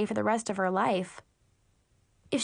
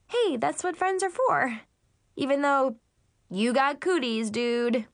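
put-on high, bratty little-girl voice; squeaky kid-sister teasing; Brianna impression; 4 semitones higher than usual. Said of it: "my wacky impression of Brianna".

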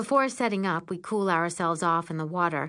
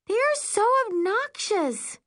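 high, slow, drawn-out grumble; grumbling; 3 dB louder, slower than usual. A narrator's reading. Wet Carly complains about the other girls, high grumble. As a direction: grumble it slowly in a raised voice. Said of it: "a dripping wet Carly grumbles".

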